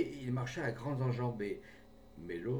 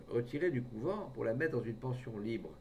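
plain reading voice

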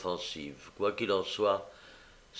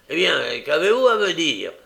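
gruff; 6 semitones higher, 9 dB louder than usual. A narrator's reading. Sikes demanding gruffly.

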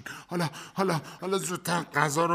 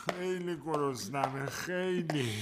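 hungry moan; 6 dB quieter, slower than usual. Acted. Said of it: "دارم از خستگی و گرسنگی میمیرم".

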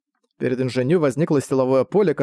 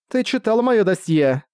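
higher-pitched urgent challenge; fierce and desperate; 7 semitones higher than usual. A narrator's reading. Prisoner challenging the man judging her, fierce and desperate. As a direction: demand an answer, pitch up but volume held. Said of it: "заговорила инженю яростно и отчаянно".

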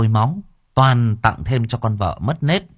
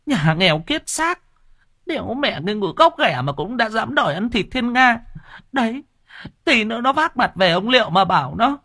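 shrill nagging wife; 14 semitones higher than usual. Acted. Wife shrilly berates her husband, very high and fast. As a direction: nag him shrill and fast. Wife's held voice.

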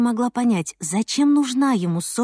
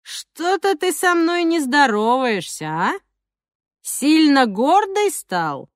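slow, sneering street thug; menacing thug voice; 5 semitones higher, slower than usual. Acted. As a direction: drawl it like a yard bully picking a fight. Thug Zhokh taunting Mitya.

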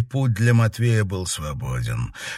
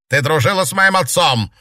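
loud, higher outburst; accusing shout; 6 semitones higher, 5 dB louder than usual. A narrator's reading. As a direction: hurl the accusation.

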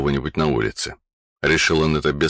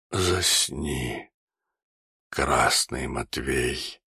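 hoarse dying rasp; slower than usual. Acted. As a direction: rasp out last words, fading. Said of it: "прохрипел". (хриплый предсмертный голос)

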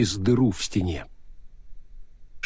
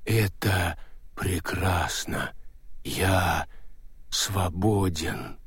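slow stone monotone; 4 semitones lower, 6 dB quieter, much slower than usual. Acted. Golem ghost speaks.

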